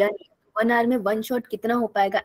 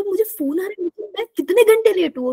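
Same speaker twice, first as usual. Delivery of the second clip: higher, startled, half-mock panic; panicked jolt awake; 7 semitones higher than usual. Teacher retelling being woken for a viva, mock-panicked and higher. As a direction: replay waking up in a panic, pitched up. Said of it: "और मैं ना डर गई".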